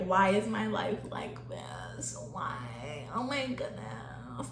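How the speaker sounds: slow, hushed self-pitying whine; drawn-out, soft mock despair; mock woe-is-me whisper. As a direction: whisper it like a retold pity party. Retelling old victim mentality complaint.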